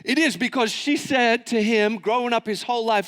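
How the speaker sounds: ordinary delivery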